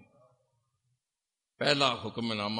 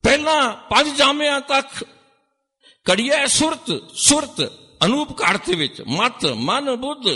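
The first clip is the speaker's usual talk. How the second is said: high, loud, ringing sermon voice; preaching at full force; 13 semitones higher, 8 dB louder than usual. Acted. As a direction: proclaim it to the whole sangat. (ਉੱਚੀ ਸੁਰ ਵਿੱਚ ਜੋਸ਼ੀਲਾ ਪ੍ਰਚਾਰ)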